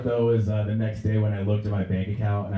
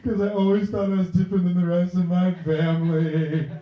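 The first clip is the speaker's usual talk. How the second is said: mock crying; 10 semitones higher, 3 dB louder than usual. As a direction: wail it like a hammed-up crybaby. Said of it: "And boy, was I a crier".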